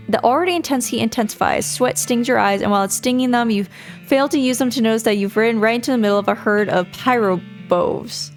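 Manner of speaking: ordinary delivery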